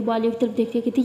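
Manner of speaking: plain reading voice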